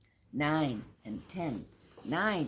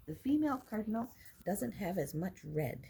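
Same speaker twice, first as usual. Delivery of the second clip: ordinary delivery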